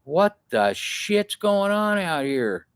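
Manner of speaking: high, slow, drawn-out grumble; stretched, high-pitched old-guy voice; older camper guy. Retold or imitated. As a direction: imitate a baffled older man calling out. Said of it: "There's another older guy there in his camper".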